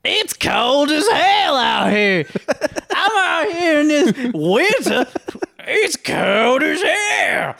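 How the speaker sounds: very high, drawn-out hammed-up holler. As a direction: holler a crude caricature, far above your own pitch.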